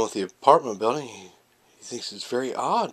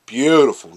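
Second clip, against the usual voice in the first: BEAUTIFUL punched much louder, first syllable stretched; emphatic gushing; 3 semitones higher, 11 dB louder than usual. Retold or imitated. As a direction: hit "beautiful" hard, drawing out the start. Gushing over the gorgeous girl.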